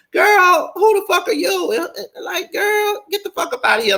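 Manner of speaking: very high, fast, put-on sassy voice; shrill sassy clapback. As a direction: screech the comeback as a mock Shay. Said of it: "if I was Shay".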